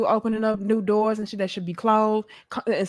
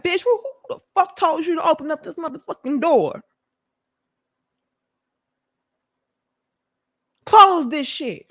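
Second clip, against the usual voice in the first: mock angry thug; 6 semitones higher than usual. Intruders bursting in, yelling at her for opening the door. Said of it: "gorillas hopping out like".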